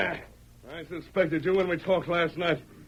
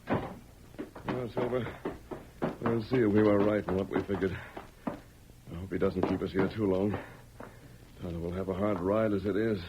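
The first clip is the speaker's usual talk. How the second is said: low confiding murmur; 12 semitones lower, 5 dB quieter than usual. Acted. Quietly confiding to his horse.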